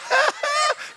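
short high-pitched laughing burst; mock joyful laugh. Imitating the joyful rich woman, high laugh. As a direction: cackle as if overflowing with joy. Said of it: "she's just full of joy".

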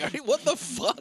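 high-pitched mock shock; stunned disbelief. Watching the film with mouth open, stunned disbelief.

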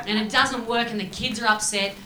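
ordinary delivery

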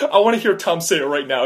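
loud, quick, tearful demand; mock sobbing. Bar owner demanding to be loved, mock sobbing. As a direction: demand it fast, hamming up the sobs. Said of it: "just starts sobbing".

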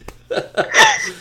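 bursting high laugh; giddy burst of laughter; high giggle. Real, not acted. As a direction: laugh at your own joke. Laughs at own murder joke.

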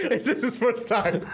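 high squeal of laughter; squealing laughing repeat. Real, not acted. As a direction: laugh the question out. Repeats question laughing hard.

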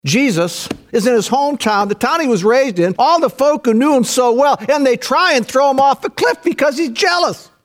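high, heated sermon storytelling; impassioned, high-pitched preaching; full-force preaching. Acted. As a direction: build to an outraged preacher's shout.

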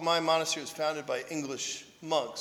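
normal talking voice

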